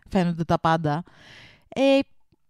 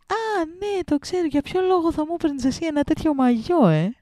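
pitched way up, mock sweet and pointed; sarcastic reply; 8 semitones higher than usual. Retelling her sarcastic reply to Mandi, mock sweet and high. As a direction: feign innocence with a sugary high voice. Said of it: "Και ήμουν σε φάση".

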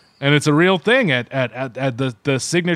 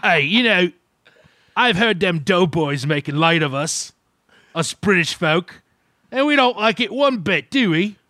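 higher, huffy mock British accent; offended Brit; 4 semitones higher than usual. Host as British folk offended by the podcast, huffy British accent. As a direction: play a miffed Englishman scolding the Americans.

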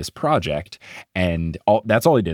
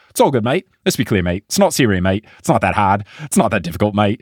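Steven Adams; 4 dB louder, faster than usual. Retold or imitated. Podcaster quoting player dismissing a question, louder New Zealand accent. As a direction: quote it brightly with Kiwi "mate". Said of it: "And then he says".

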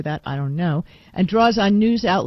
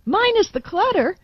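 much higher, disbelieving echo; incredulous; 12 semitones higher than usual. Real, not acted.

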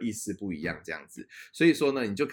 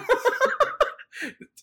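high falling burst of laughter; 6 dB louder than usual. Real, not acted. Realising the sign meant crossroads, loud high laugh.

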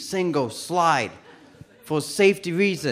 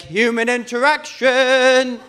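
high, drawn-out holler building louder; outside voice; 5 semitones higher, 4 dB louder, slower than usual. Retold or imitated. Tripping man to a stranger in line, loud outside voice. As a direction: blurt it out slowly, far too loud. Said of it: "No, it was my outside voice".

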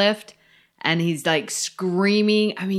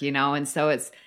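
ordinary talk, nothing marked

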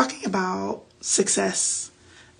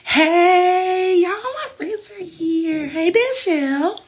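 excited greeting; 9 semitones higher, much slower than usual. Real, not acted. Friends join the live stream.

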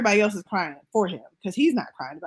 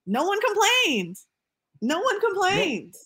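emphatic disbelief; 10 semitones higher, slower than usual. Real, not acted. Repeated emphatic point.

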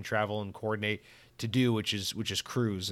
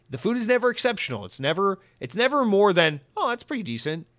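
excited rant; 9 semitones higher, 4 dB louder than usual. Real, not acted. Excited cruise food rant.

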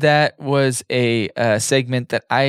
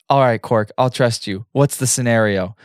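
ordinary talk

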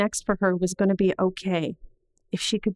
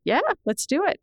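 bright, much higher, eager agreement; eager yes; 10 semitones higher than usual. Retold or imitated. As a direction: jump at the idea happily.

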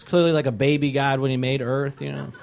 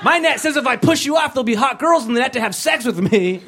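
mock zealot; 8 semitones higher than usual. Pushy believer voiced.

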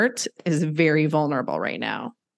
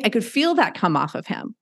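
ordinary delivery

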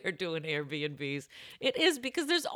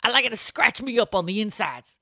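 louder, hammed-up country landlady; creepy backwoods host; 4 semitones higher, 5 dB louder than usual. Host serving homemade pate to guests, hammed-up creepy pride. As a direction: play the creepy host with relish.

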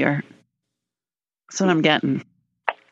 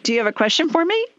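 ordinary talk, nothing marked

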